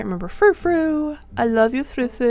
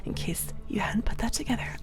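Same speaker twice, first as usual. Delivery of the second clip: whispering; 4 semitones higher, 8 dB quieter than usual. Acted. Whispered aside.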